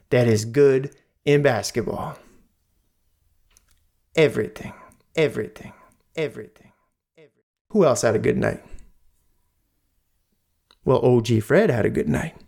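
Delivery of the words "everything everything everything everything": each everything softer until it vanishes; fading echo. Shown as a demonstration. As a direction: let the word echo off into the distance.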